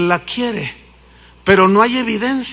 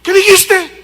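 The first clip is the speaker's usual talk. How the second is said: much higher, louder demanding question; demanding wife; 10 semitones higher, 6 dB louder than usual. Acted. Wife demands husband repeat himself, sharply. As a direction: make him say it again.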